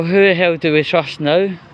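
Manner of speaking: ordinary talk, nothing marked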